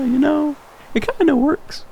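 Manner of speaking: squeaky, very high-pitched aside; comic high voice. Pretend. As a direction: jump way up high for a jokey, hedging claim. Jokingly defends his shaky accent.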